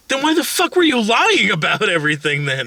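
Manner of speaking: high-pitched mock anger; indignant. Wife demands why husband lied, indignant. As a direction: imitate a wife demanding an explanation. Wife's angry confrontation acted out.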